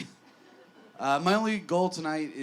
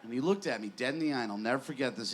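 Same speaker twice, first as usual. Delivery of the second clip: normal talking voice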